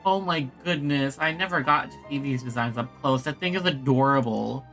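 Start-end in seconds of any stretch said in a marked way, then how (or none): none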